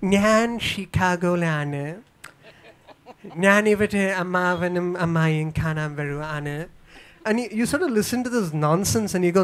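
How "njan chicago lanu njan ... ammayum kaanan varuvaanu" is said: slow, exaggerated caricature of an NRI speaking Malayalam; amplified NRI Malayalam accent. Mocking how cinema's NRI talks to the family. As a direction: ham up the returning-emigrant accent. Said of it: "so amplified, the accent".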